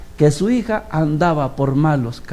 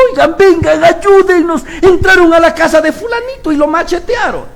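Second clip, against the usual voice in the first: high, loud urgent cry; calling for help; 10 semitones higher, 4 dB louder than usual. Retold or imitated. Neighbour bursting in to the gathering, shouting for help. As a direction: call out for help, alarmed.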